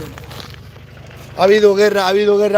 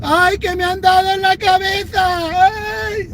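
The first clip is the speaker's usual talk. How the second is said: hammed-up wail, much higher; mock a crying patriot hit by police; 8 semitones higher, much slower than usual. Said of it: "se echan a llorar". (llanto fingido y exagerado, mucho más agudo)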